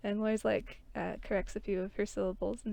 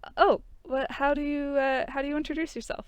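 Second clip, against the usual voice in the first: perky curious; 6 semitones higher, 5 dB louder than usual. Acted. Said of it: "he kind of perks up and he's like".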